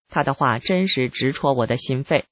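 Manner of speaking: ordinary talk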